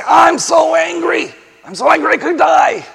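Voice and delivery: high, loud whiny rant of rage; mock angry outburst. Preacher voicing Jonah's tantrum, high mock shout. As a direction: cry it out like a sulking prophet. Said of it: "How many times does it say".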